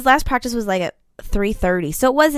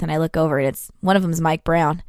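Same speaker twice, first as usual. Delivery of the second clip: usual speaking voice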